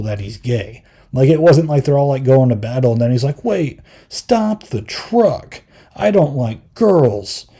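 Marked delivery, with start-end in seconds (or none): 3.5-7.5 higher, slow, hammed-up blurt; slow, exaggerated mock-dramatic outburst; mock dramatic announcement. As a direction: ham up a sudden confession. Mocking an abrupt coming-out.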